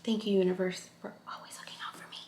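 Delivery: hushed, grateful whisper; whispered thanks. Real, not acted. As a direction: whisper it like a private little prayer. Whispered aside of thanks to universe.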